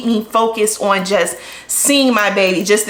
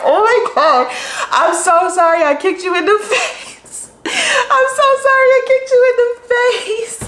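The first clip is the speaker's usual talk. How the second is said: giggling apology, much higher; laughing apology; 12 semitones higher than usual. Retold or imitated. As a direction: say sorry while cracking up. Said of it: "I was like".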